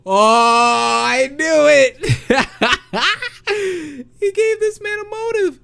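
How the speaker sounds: high, loud, drawn-out gleeful crowing; shrill victorious character voice cut with laughter; triumphant gloating. Acted. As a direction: gloat over being proven right.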